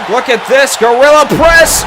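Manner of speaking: excited shout. Real, not acted. Calls a big move.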